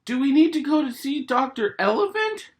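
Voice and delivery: put-on high, loud worried child; piping little girl, anxious; high piglet voice asking in worry; Peppa's voice.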